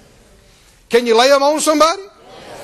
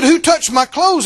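Jesus asking; 5 semitones higher, 5 dB louder than usual. Acted. Jesus voiced in Bible reading.

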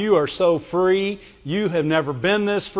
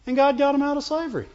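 usual speaking voice

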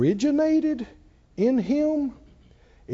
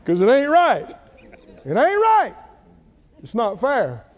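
higher, louder aggrieved cry; mock indignant outburst; 4 semitones higher, 5 dB louder than usual. Preacher acting out a bitter person's complaint, mock indignant. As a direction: whine it out like someone wronged.

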